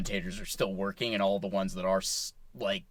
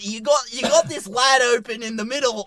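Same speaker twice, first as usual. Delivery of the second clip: put-on Danny Morrison New Zealand accent; 10 semitones higher, 5 dB louder than usual. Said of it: "Such a good accent".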